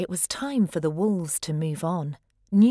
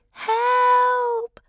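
high, loud desperate cry; howled cry for help; 6 dB louder than usual. A narrator's reading. Lost pup crying out for help in the blizzard, howling. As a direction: yell for help into the storm. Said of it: "Wilf longed to howl".

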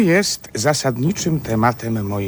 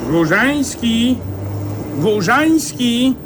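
high, slow call drawn out across a distance; a dream figure hailing the boy from afar; calling out a name; 10 semitones higher, slower than usual. Acted. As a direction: call the boy's name from far away, drawing it out.

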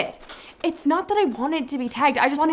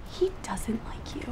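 snide whisper; 11 dB quieter than usual. Acted. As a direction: whisper it sneakily. Snide aside to the boyfriend.

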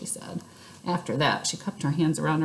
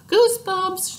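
high, loud excited shout; 14 semitones higher, 7 dB louder, slower than usual. A narrator's reading. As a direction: yell it out in triumph. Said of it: "shouted Sheldon".

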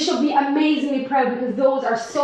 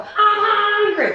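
mock whine; 4 semitones higher, slower than usual. Mimicking kids asking for food.

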